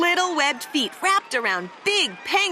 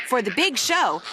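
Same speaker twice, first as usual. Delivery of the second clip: usual speaking voice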